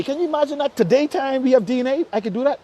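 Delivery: ordinary talk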